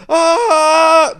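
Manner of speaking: long, high, loud wail; mock burning scream. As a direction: scream like you've caught fire, hammed-up. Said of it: "he starts catching on fire".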